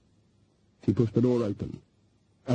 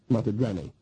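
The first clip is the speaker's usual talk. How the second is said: ordinary delivery, nothing marked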